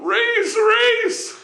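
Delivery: very high, loud mock wail; pleading husband. Husband calling to his wife under arrest, wailing plea. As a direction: call her name in cartoonish desperation.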